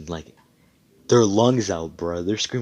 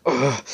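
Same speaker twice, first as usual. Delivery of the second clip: short pained grunt, higher and louder; 6 semitones higher, 4 dB louder than usual. Acted. Stabbed victim gives a pained grunt. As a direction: grunt as the blade goes in.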